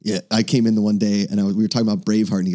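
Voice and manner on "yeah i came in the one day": usual speaking voice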